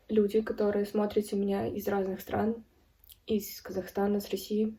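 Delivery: ordinary talk, nothing marked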